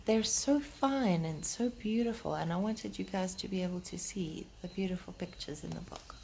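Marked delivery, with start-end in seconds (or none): 2.4-6.2 whisper. Real, not acted.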